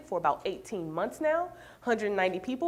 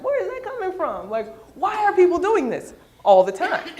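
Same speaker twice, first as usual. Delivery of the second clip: puzzled; 9 semitones higher, 7 dB louder than usual. Retold or imitated. Retelling her own puzzled reaction.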